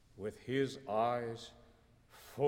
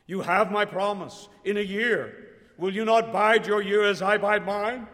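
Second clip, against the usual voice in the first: higher and louder insistent plea; urgent raised plea; crying out; 6 semitones higher, 10 dB louder than usual. A narrator's reading. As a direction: insist on her promise, voice rising. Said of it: "Nay," she cried".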